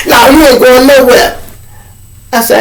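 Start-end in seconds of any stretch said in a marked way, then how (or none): 0.1-1.4 higher, louder flat refusal; blocking threat. Retold or imitated. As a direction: plant yourself in the doorway and refuse. Inmate blocking the door.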